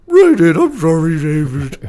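loud, slow cartoon dog voice; high, booming doggy apology; Scooby-Doo. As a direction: talk like a big cartoon dog. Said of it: "dog voice was always a Scooby-Doo kinda".

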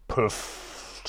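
whispered pfff sound; a breathy voiceless puff; soft pff. Acted.

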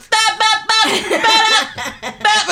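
very high babbling; blah-blah babble way up high; mock babble. Mocking a bore's talk with very high babble. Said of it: "that high voice".

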